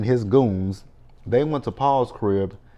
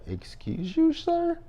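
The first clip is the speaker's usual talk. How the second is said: high, indignant, mocking pitch; sassy excuse you; 3 dB quieter, slower than usual. Reacting to a rude line in the show, indignant and high. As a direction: huff it out with a sharp rise. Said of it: "I was like".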